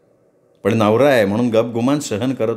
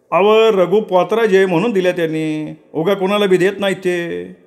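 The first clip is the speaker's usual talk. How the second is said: higher, complaining farmhand; servant Saravanya; 7 semitones higher than usual. Acted. Servant Saravanya complaining to Raghu about the load, lightly voiced. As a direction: grumble about the heavy sack.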